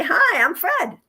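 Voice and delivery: a lightly squawked parrot hello; parrot imitation. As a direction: mimic the parrot greeting. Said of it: "he'll say".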